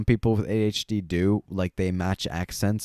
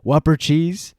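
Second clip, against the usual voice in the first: clipped Filipino accent, louder; 6 semitones higher, 6 dB louder than usual. Shown as a demonstration. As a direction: say Whopper cheese the Filipino way. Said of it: "I'm accidentally using a Filipino accent".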